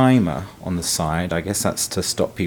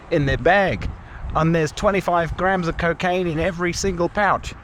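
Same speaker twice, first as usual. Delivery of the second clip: higher mock Canadian voice; a light Canadian TV narrator; Canadian accent; 8 semitones higher than usual. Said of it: "terrible Canadian accent".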